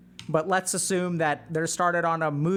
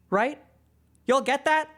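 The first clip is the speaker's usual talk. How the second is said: ordinary talk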